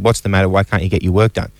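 ordinary delivery

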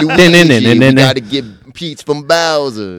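loud New York take on Mario; New York Mario accent. Acted. New York Mario rallying his brother, New York accent. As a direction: do Mario as a pushy New Yorker. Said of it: "like, a New York Mario accent".

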